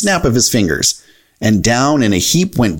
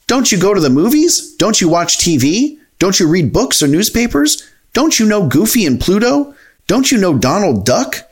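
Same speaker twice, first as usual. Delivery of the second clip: higher-pitched, indignant questioning; Donald Duck, affronted; 7 semitones higher than usual. Acted. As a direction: pile up incredulous questions in a high voice.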